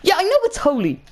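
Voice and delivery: high-pitched, snippy comeback; exasperated retort. Retold or imitated. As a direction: exaggerate a cheeky pupil's protest. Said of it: "Well, I didn't say".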